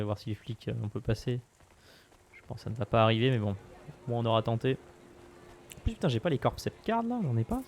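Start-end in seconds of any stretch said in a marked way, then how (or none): 5.8-7.6 fast high frustration. Real, not acted. Frustrated fast rant, higher.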